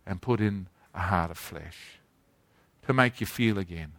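a soft, gentle promise, lightly voiced; voicing God tenderly. Preacher voicing God's soft promise to hardened hearts, gently. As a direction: speak as God, quietly reassuring the hurt. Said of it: "God, by his Holy Spirit, says to us".

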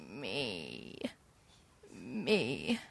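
very soft, low rasping murmur; dying old man. Retold or imitated. Old man in his chair, dying wish, very soft low. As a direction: barely breathe it out, low and soft.

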